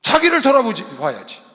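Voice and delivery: loud confession building to high shouts; full-force preaching. Real, not acted. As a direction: cry out the self-reproach, then proclaim loudly. (크게 부르짖는 설교)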